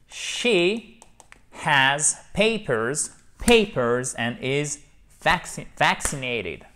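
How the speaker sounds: very slow, clapping each stressed first syllable of "papers"; clapped stress. Shown as a demonstration. Teacher clapping the stress to French learners, very slow. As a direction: beat out "papers" as PA-pers with claps. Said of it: "je tape dans mes mains".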